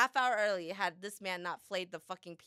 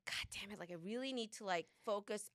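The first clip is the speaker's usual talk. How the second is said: much softer grumbling self-talk; muttered to herself; 10 dB quieter than usual. Retold or imitated. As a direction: mutter it under your breath. Retold frustrated self-talk.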